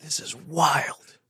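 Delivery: breathless whispered awe; a stunned whisper of disbelief; awed whisper. Real, not acted. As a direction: hush it in amazement. Awed reaction.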